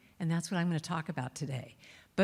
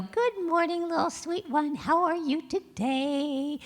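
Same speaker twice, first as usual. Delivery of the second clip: higher, slow and tender; sweet morning greeting; 9 semitones higher, slower than usual. Retold or imitated. Greeting herself lovingly on waking, a higher slow coo. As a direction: coo it like to a small child. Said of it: "you could wake up in the morning and go".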